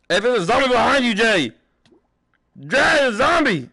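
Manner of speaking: loud, fast, high warning yell; alarmed shout. Real, not acted. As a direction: shout to warn your friend. Warning friend about a zombie.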